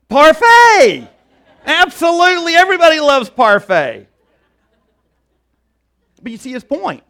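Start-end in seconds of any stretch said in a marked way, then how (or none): 0.1-4.1 very high, very loud exclamation; ecstatic Donkey. Acted. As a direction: shout it out high, like the best idea ever. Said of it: "Donkey says".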